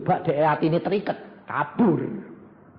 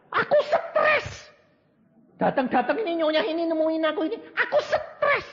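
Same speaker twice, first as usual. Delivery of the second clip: much higher, tearful whine of a stressed rich lady; whining rich lady; 12 semitones higher than usual. Retold or imitated. A rich lady complaining to the preacher, tearful high whine. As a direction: cry out your stress like a pampered madam.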